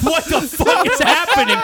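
high, loud acted disbelief; baffled outcry. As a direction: cry out in exaggerated bewilderment. Acts out baffled reaction to screaming.

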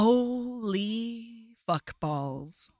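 very slow, drawn-out amazement; stunned awe. Real, not acted. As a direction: stretch each word out in astonishment. Stunned reaction to the grand gesture.

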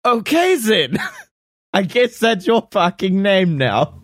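high, hammed-up giving in; exasperated scientist. Scientist accepting his clone's silly name, high mock exasperation. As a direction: give in with a high mock outburst.

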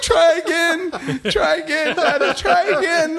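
put-on high, loud, slow wailing kid; crying toddler. Son repeating try again to dad, high wailing. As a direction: bawl it like a little kid through laughter.

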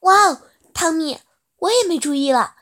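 loud, high astonished exclamation; shocked. Acted. Mother stunned by her son's news, shocked exclamation. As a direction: drop the calm, exclaim. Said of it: "震惊地说道".

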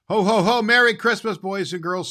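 loud, high jolly Santa greeting. Acted. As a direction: ring it out to the children.

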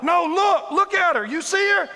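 high loud pointing out; accusing crowd. Acted. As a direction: shout it at Jesus. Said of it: "They're like".

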